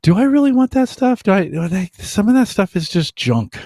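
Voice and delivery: high-pitched doubting inner voice; self-questioning. Retold or imitated. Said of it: "start having some questions like".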